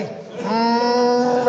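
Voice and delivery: long, slow, humming whine; mock crying like an engine in first gear; drawn-out pretend baby whimper; imitated first-gear whimper. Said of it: "first ಗೇರ್ನಾಗ ಬೀಳ್ತದೆ ಗಾಡಿ".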